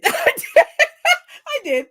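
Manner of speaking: high, helpless real laughter; words squeezed through a laugh; laughing admission. Real, not acted. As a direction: crack up while owning up. Laughs retelling own admission.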